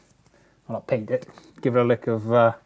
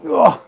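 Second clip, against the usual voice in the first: pained groan; 9 semitones higher, 10 dB louder than usual. Real, not acted. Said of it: "Unlike my back, which is ruined".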